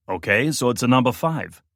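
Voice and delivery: dry, loud sarcastic remark. Acted. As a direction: state the obvious with a sneer. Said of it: "observed sarcastically".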